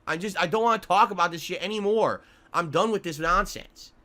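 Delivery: normal talking voice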